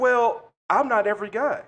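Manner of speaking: lightly voiced smug creep who thinks he's special; a hint of a self-satisfied creep; smug mock retort. Creep's smug reply retold.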